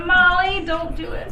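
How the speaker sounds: calling out. Real, not acted. Calling out to character.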